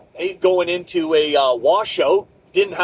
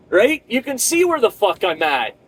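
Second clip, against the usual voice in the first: ordinary talk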